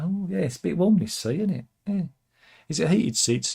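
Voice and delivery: high-pitched, curious impression; Kim Jong-un. North Korean leader asking about the warm seat, high impression. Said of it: "I imagine Kim Jong-un talks like that".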